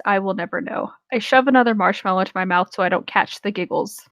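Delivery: ordinary delivery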